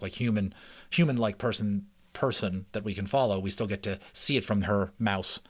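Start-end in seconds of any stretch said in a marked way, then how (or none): none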